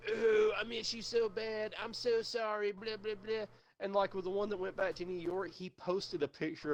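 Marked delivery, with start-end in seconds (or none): 0.1-3.5 mock dramatic sobby pleading; mock sad Facebook post. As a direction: mimic a guy begging his ex back online. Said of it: "he'll be like".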